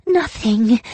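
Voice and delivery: high moaning despair. Acted. Farmer woman moans her despair to Yang Chen, high. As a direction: let the word sag into a moan. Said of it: "she moaned".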